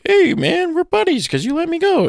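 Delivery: high squeaky pleading voice; mock pleading. German begging the ammo guy to spare him, high pleading. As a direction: beg for your life in a thin high voice.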